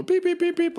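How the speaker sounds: high robot beeping; droid beeps. Pretend. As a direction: chirp like a little droid. Imitating R2 beeps.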